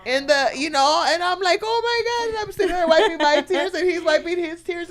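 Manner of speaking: overcome, high-pitched recounting; high emotional retelling. Real, not acted. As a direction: pitch way up, words spilling out with feeling. Retelling tearful moment.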